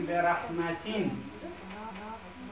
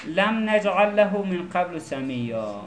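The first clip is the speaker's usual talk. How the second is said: slow, measured Arabic recitation; careful teacher's recital of scripture; Quran recitation; slower than usual. Shown as a demonstration. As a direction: recite with long held vowels.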